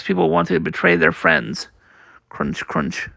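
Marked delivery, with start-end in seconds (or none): 2.3-3.1 soft, voiceless crunching; hushed sound effect. Acted. Reader sounds out munching in the comic, hushed. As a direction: whisper the crunch like a small noise.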